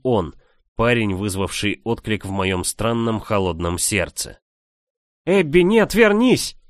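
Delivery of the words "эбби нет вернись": shrill, much higher panicked scream; panicked frantic pleading. Acted. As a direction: scream it after her in terror. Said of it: "в панике закричала Мари".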